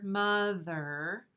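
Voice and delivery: "mother" stretched slowly into two parts; syllable by syllable. Shown as a demonstration. Teacher showing first graders two word parts, "mother" syllable by syllable. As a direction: draw out both halves of "mother". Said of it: "two parts to the word mother".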